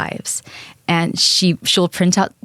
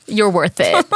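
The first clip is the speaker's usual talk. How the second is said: laughing. Real, not acted. Giggling while giving a gift.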